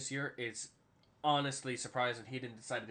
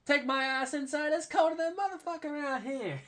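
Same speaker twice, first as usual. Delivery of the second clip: acted complaint; 14 semitones higher, 5 dB louder than usual. Player heading inside from the cold, high loud mock holler.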